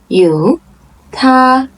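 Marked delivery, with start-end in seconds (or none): 1.1-1.7 他 held high and level; first tone. Shown as a demonstration. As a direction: keep 他 on one high pitch. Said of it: "first tone".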